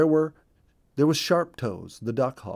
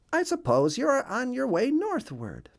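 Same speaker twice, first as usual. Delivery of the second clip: much higher, put-on duck voice; duck character voice; 14 semitones higher than usual. Missus Mallard asks Bluebird about his trip. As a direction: play the duck asking politely.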